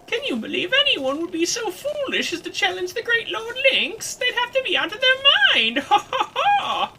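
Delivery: very high, loud hammed-up crone; cackling mocking crone. Crone mocking anyone who challenges Lynx, gleefully scornful. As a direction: scoff theatrically, breaking into an old crone's cackle.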